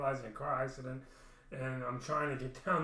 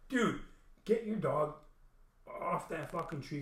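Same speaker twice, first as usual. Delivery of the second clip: demanding; 4 semitones higher, slower than usual. Retold or imitated. Confronting neighbor about his dog.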